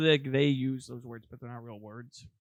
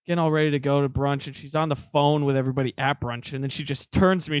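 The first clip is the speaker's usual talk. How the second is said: usual speaking voice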